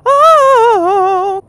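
long, loud high "oh"; loud falsetto howl; high sung wail. Pretend. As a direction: hold a wailing high note. End of the sung line.

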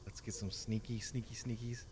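soft sneaky whisper. Real, not acted. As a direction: hush it, sneaking up. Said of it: "I'm whispering".

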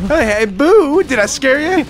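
high loud spooky voice. Pretend. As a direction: try to scare them, ghost-style. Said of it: "I'm trying to be spooky".

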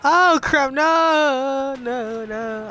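high, loud drawn-out cry, fading; real alarmed shout. Real, not acted. Player watching a kill slip away, alarmed shout. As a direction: shout in dismay, then sink away. Game shouting as about to die.

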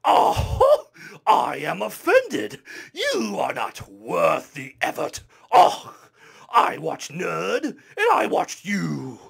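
loud, slow, hammed-up pompous outrage; imagined commenter voice. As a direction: huff like a mortally offended snob. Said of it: "in the voice I imagine".

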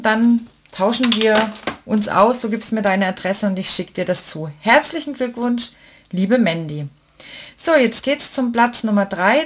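normal talking voice

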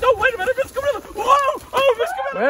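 high, loud frantic cries; panicked protest. Acted. Hostess carried off by the gorilla, frantic high cries. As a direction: scream as you're dragged away.

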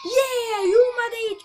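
loud, high cheer of triumph; victory shout. Real, not acted. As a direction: yell out the win. Triumphant cheer after passing level.

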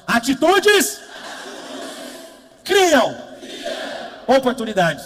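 high, loud shouted words with a laugh. Shown as a demonstration. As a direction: shout it out for the crowd to repeat. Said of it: "Fala assim, ó". (palavras gritadas, altas e agudas)

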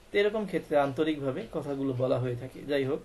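ordinary talk, nothing marked

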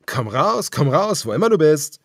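villain's mocking call. A narrator's reading. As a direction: coax the hidden man out cruelly. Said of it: "rief der Anführer".

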